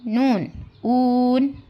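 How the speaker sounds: slow, stretched "ऊन" with a long oo sound; drawn-out teacher demo. Shown as a demonstration. Teacher sounding out "ऊन" slowly for young learners. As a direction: stretch the vowel of "ऊन" for the class. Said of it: "वाव, ऊ".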